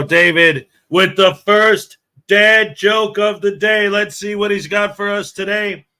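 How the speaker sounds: big, slow, loud announcer. Acted. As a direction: boom it out like a host. Introducing the joke segment.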